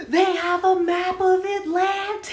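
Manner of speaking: very high, drawn-out yell; imitated crank's wail, stretched and loud; desperate parting shout. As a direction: holler it while being shoved out the door. Man shouts while pushed out.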